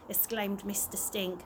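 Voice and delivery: ordinary delivery, nothing marked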